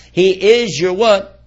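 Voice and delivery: slow, drawn-out question to the crowd; prompting question. Real, not acted. Preacher quizzing the congregation, slow and leading. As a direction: hold it and wait for them to fill it in.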